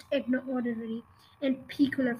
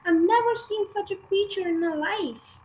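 put-on protesting girl; 6 semitones higher than usual.

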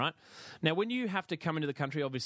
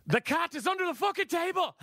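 much higher and louder, "fucking" hit hardest; furious shout; 4 dB louder than usual. Retold or imitated. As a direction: yell "The cat is under the fucking table" in total exasperation. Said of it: "read the following".

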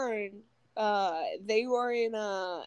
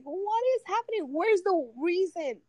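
exasperated disbelief; 7 semitones higher, 3 dB louder than usual. Retold or imitated. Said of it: "And I'm like".

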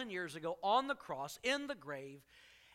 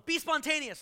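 mock command; 9 semitones higher, 6 dB louder than usual.

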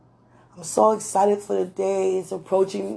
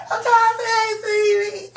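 laugh-crying high shout; 12 semitones higher than usual. Real, not acted.

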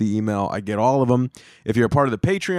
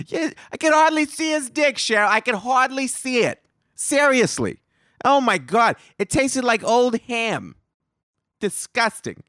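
much higher mock gossip voice; gossiping salon woman; 9 semitones higher than usual. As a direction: ham up a catty salon lady. Women in hair salon gossiping.

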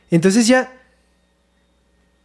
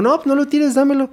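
higher, pleading no lo tires; mock pleading, pitched higher; 7 semitones higher than usual. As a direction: beg him not to throw it away.

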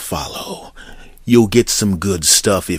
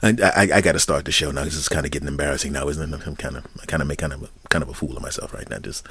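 embarrassed rush; 6 semitones lower, 8 dB quieter, faster than usual. Real, not acted. Embarrassed, rushing self-mockery.

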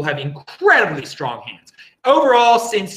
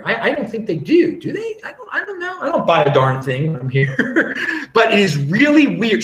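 laughing; 4 semitones higher than usual. Real, not acted. Laughing at odd ads.

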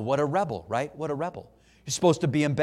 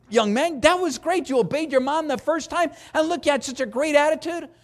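put-on higher, bright cheering of a child; enthusiastic praise; 8 semitones higher than usual. Stranger praising an obedient child in the grocery store, cheerfully. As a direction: cheer the kid on like a delighted stranger.

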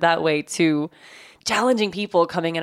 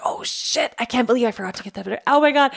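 panicked realisation; 6 semitones higher than usual. Retold or imitated. Retelling her own panic.

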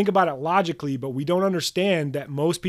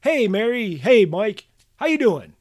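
mock small talk; 5 semitones higher, 4 dB louder, slower than usual. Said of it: "it's like".

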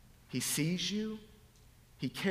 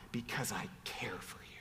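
hushed, voiceless whisper; 6 semitones lower, 9 dB quieter, faster than usual. Acted. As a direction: whisper it tenderly. Whispered then repeated aloud.

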